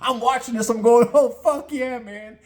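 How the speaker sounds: high, whooping excitement; exultant high-pitched yeah; gleeful cheer. Retold or imitated. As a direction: cheer it on like a thrilled spectator. Gleeful reaction to shark attack.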